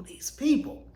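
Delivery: "these people" whispered, rising high on "people"; crying whisper. Retold or imitated. Fearing breast cancer, a whispered tearful "these people". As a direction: repeat "these people" as a choked, whispered plea. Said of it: "I just broke down crying".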